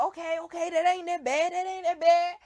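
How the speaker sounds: high and loud, talking yourself down; high, loud relief that isn't convincing; mock relief. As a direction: nervously reassure yourself. Reading, reassuring self.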